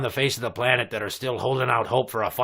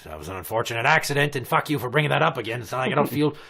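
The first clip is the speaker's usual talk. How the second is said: ordinary delivery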